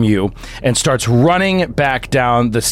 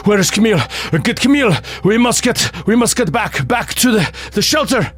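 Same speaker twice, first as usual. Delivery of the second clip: higher, urgent call for his wife; frantic man rallying to the shelter; father in panic during shelling; panicked father; 9 semitones higher, faster than usual. Acted. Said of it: "and he's like".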